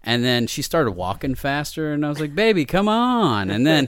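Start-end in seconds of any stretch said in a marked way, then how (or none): 2.3-3.5 loud, high drawn-out plea; playful pleading. Retold or imitated. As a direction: call after her, stretching the words. Retold pleading after walking-off girl.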